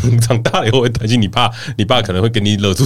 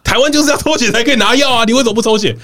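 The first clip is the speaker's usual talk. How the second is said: angry; 11 semitones higher than usual. Retold or imitated. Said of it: "我就突然很生气".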